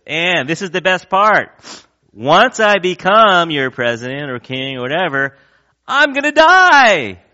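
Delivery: hammed-up royal promise ending on a squeak; mock king's speech. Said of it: "the king goes".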